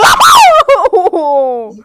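big burst of loud laughter; loud laughing fit. Real, not acted. Laughing at friend's death.

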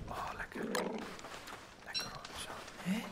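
soft unvoiced whisper. Real, not acted. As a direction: keep it to a hush. Whispering while eating.

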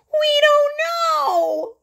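long, high, drawn-out wail; scared boy wails to the policeman. Acted. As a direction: let it stretch into a desperate child's wail. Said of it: "Ralphie wailed".

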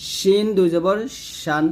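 শিন with a clear sh from mid-tongue to palate; hushing sh in শিন and শান; shīn sound. Shown as a demonstration. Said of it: "শিনটা জিহ্বার মধ্যখান". (শিন জিহ্বার মাঝখান তালুতে লাগিয়ে)

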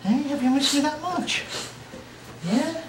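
high sing-song coo at the dog; high doggy cooing. Real, not acted. Dad coos to his excited dog, high and sing-song. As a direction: lift it up high and coo to the pet.

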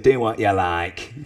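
lightly voiced, slow and offhand brush-off; a hint of a gruff old MP waving him away; dismissive Dennis Skinner. Skinner brushing off the Speaker, slow and mildly dismissive. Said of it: "waved his arm at me and said".

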